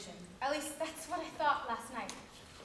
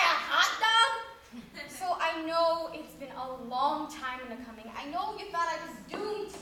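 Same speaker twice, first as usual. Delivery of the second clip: ordinary talk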